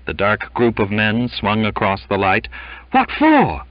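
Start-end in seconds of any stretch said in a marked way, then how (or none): 2.9-3.6 very high, loud outburst; indignant exclamation. A narrator's reading. Crewman protesting the captain's order, shocked and indignant. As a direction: burst out in disbelief. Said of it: "exclamations of surprise, triumph, indignation".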